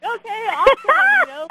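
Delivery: high, bright-eyed "okay, awesome"; mock excited. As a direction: play it overly thrilled and high. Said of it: "And I'm like".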